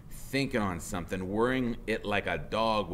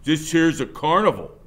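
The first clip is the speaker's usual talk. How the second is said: louder, higher jeer; mocking biker; 6 semitones higher, 9 dB louder than usual. Biker jeering at kids, louder. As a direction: laugh at them with the line. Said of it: "he says".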